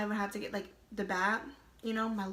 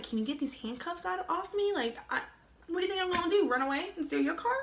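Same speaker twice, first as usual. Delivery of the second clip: higher, incredulous pleading thought; exasperated inner voice; 7 semitones higher than usual. Retold or imitated. Handcuffed driver silently pleads with the cop, exasperated and higher. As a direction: roll your eyes at the cop in your head.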